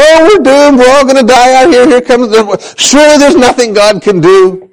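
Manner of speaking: high, hammed-up doomed wail; mock despair, pitched high and quick; mock moaning. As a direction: imitate a panicking crowd groaning in despair. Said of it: "they're all moaning, groaning".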